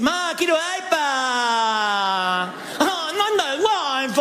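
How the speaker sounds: put-on high, whining spoiled child; whiny kid. Spoiled child whining to mom for iPad, high and whiny. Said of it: "esos nenes que dicen".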